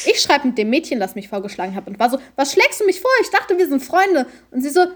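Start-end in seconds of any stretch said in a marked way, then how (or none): 2.4-4.3 high-pitched, affronted retelling; mock indignation. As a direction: act out a betrayed friend's protest. Acted quote confronting a friend. (gespielt empört, mit hoher Stimme)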